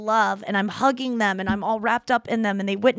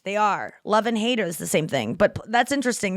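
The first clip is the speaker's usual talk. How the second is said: ordinary talk, nothing marked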